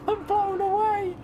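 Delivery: high, slow and stunned; overwhelmed disbelief. Real, not acted. Streamer thanks a viewer for a huge gift, stunned and high. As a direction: say it high and slow, still in shock.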